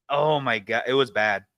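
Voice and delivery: ordinary talk, nothing marked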